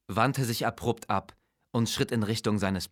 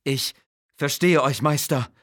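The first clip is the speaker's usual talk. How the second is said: higher, louder, a touch of fearful vizier from the dust; mildly fearful servant voice, higher; 6 semitones higher, 7 dB louder than usual. Acted. Vizier face in the dust answers his master, mildly fearful. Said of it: "sagte er furchtsam".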